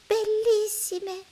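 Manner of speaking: very high, slow, drawn-out; smiling girl. A narrator's reading. Girl smiling at the clouds, very high and slow. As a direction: smile through the word. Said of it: "sorride Marianna".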